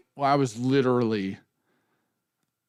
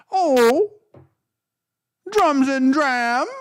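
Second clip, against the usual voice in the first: put-on intro voice; 12 semitones higher, 6 dB louder, much slower than usual. Trying out a show intro.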